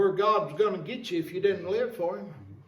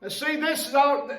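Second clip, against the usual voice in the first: higher, louder, rising sermon push; fervent preaching; 5 semitones higher, 3 dB louder than usual. Acted. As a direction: drive the point home to the church. Preaching at full force.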